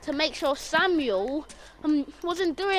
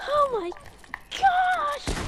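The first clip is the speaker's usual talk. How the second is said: high-pitched, drawn-out mock freak-out; hammed-up panicked exclamation; 12 semitones higher, much slower than usual. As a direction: act out a stretched, high cry of panic. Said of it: "we were just freaking out".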